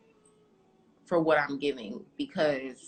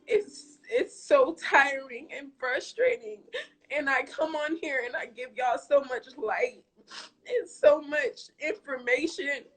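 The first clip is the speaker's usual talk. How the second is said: choked-up, high and strained voice; barely holding back tears; tearful; 7 semitones higher, 3 dB louder than usual. Real, not acted. Streamer tells viewers about her grandpa, choked up.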